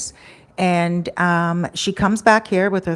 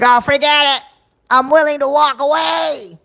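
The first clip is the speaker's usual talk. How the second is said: higher and louder mimic of a distraught woman; mimicking upset friend; 8 semitones higher, 5 dB louder than usual. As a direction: do her upset voice, pitched up and loud. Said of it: "so I'm just gonna mimic her".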